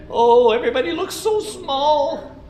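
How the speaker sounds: high, awed little beaver voice; beaver looking down. Acted. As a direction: marvel at the view from the top. Beaver looks down from top.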